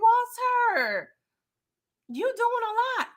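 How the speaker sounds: high, drawn-out exasperation; a high, stretched-out tsk at Walter on screen; exasperated scold. Real, not acted. Host scolds character.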